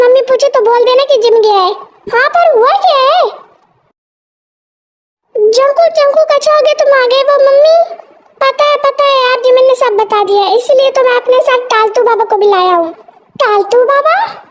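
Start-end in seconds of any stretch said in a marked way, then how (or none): none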